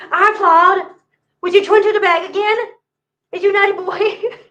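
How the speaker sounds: put-on high, loud baby-talk to a cat; pet-talk scolding. Playful scolding of her cat.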